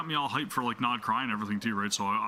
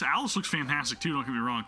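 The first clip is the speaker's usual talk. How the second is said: normal talking voice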